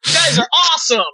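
high, slow, half-crying mock complaint; sarcastic outburst. Real, not acted. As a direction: wail it out sarcastically.

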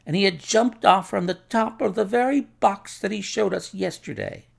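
high-pitched, distressed retelling; agitated account. A narrator's reading. Shaken doctor telling Holmes how the body was found, high and agitated.

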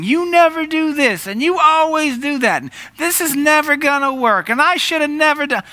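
mock upset spouse, high and pinched; emotional outburst. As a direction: fling out the exaggerated complaints like someone out of peace. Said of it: "we say emotional things".

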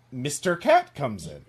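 ordinary talk

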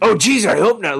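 high, anxious little worry; Doofus Rick voice. Retold or imitated. Doofus Rick worrying he offended Jerry, high and nervous. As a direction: say it like a nervous goof. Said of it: "he's all like".

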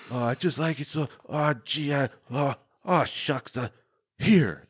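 high, shy aw-shucks voice; bashful prom date. Retold or imitated. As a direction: play the awkward boy at the door. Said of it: "he just goes like".

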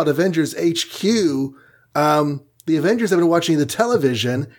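normal talking voice

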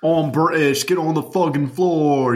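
lightly put-on British accent; mock British accent. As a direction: play a British thug, half joking. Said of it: "You gotta say it with, like, G's".

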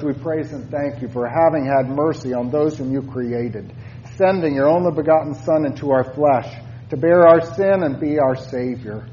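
ordinary talk

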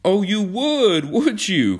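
high, loud, drawn-out indignation; ferocious cry. A narrator's reading. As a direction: stretch it out in a ferocious challenge. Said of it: "cried John ferociously".

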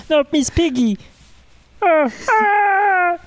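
shrill Miss Piggy impression, breaking into laughs. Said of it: "poorly done Muppet impressions".

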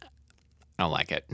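slow, soft, deadpan verdict; quiet and flat. Real, not acted. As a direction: drop it low and slow. Dry verdict on the name.

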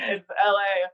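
tearful. Retold or imitated. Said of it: "I was crying in the car".

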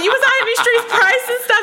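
high, incredulous laughing question; comic high-pitched astonishment; retold disbelief. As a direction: replay a shocked challenge to a partner. Retelling her shocked reply.

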